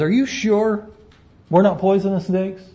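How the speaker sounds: nervously double-check, as a fussy snake; worried snake. Acted.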